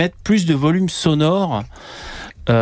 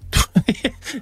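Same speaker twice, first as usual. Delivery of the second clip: rire aigu; 9 semitones higher than usual. Real, not acted. Joie d'avoir réglé le son.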